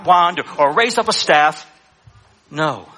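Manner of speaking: ordinary delivery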